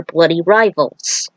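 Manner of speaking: ordinary delivery, nothing marked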